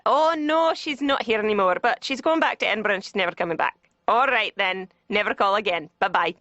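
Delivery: hammed-up Scottish brush-off; bad Scottish accent. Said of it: "like, some really bad, you know, Scott".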